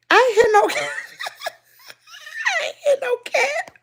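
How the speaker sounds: high-pitched denial breaking into laughter; laughing protest. Real, not acted. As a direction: protest high while cracking up. Laughing denial of viewer's teasing.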